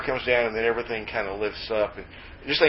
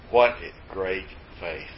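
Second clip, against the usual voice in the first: low, soft and slow; hushed quoted verdict; 7 semitones lower, 5 dB quieter, slower than usual. Retold or imitated. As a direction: voice God's verdict quietly, spacing each word. Voices God's surprising verdict, deadpan.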